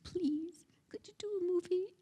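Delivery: high, small begging voice; pleading request. Retold or imitated. Speaker asking a movie bigwig friend, high pleading. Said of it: "And I said".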